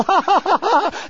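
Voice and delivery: high, hammed-up fake cackle; mock high laugh. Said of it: "the people with him go".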